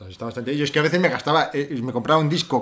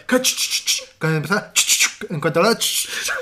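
whispered insistent "ch, ch, ch" shush; imitated shushing; 3 semitones higher than usual. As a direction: chistar sin voz, insistente, para hacer callar. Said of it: "le empezaba a parar". (chistido sin voz, repetido)

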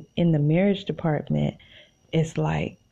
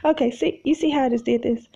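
excited; 8 semitones higher, faster than usual. Real, not acted. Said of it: "I talked myself happy".

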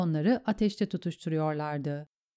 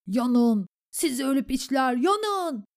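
high, raised battle cry; shouting; 7 semitones higher, 3 dB louder, slower than usual. A narrator's reading. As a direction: yell a curse at the enemy. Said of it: "diye bağırıyordu Dywin". (bağırarak, öfkeli savaş narası)

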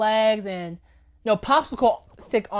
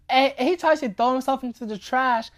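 ordinary delivery

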